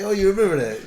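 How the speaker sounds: loud laughing question. Real, not acted. Teasing a friend who remembers the speech, laughing loudly. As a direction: laugh it out in disbelief.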